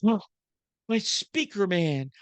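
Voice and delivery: high, syrupy mock-admiring wife voice; mock adoring wife. Wife mock-gushing over her speaker husband, high syrupy voice. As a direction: gush over him like a starstruck wife, sarcastic. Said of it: "my wife looks at me and says".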